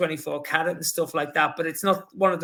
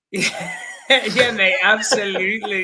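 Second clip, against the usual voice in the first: laughing; 3 semitones higher, 5 dB louder than usual. Retold or imitated. Retold eager yes, laughing.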